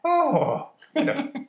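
very high-pitched leprechaun Irish. Acted. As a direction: squeak it like a cartoon Irish leprechaun. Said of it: "You want a stereotypical Irish kinda".

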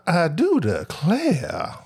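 slow, drawn-out Georgian drawl; hammed-up elegant Georgia Southern accent. Shown as a demonstration. Comedian showing his guest how he does a Georgian accent, drawn out. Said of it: "my Georgian accent consists of".

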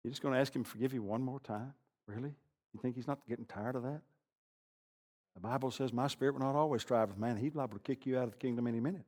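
soft, low, needling accuser; a hint of the sneering accuser; accusing inner voice. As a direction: whisper the doubts like a taunting voice. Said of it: "you hear that voice say".

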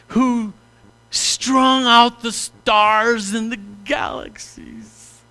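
grand churchy mock-reverent intoning; sanctimonious prayer. Shown as a demonstration. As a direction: ham up an overblown holy prayer. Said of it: "these sanctimonious prayers".

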